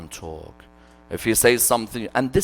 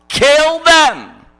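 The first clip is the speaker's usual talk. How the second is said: higher, louder voiced verdict; mock harsh command; 11 semitones higher, 7 dB louder than usual. Preacher acting out Umar ordering the captives killed, loud. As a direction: bark it out as the stern caliph.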